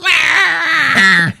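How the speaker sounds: high, loud cartoon yelp of pain; hammed-up cry of the victims; mock scream. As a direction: yelp as if slashed by a paw. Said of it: "they're like".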